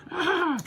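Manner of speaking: high put-on laugh; short gloating mock cackle; villainess cackle. Rita watching the battle from above, high cackle.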